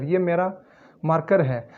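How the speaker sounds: ordinary talk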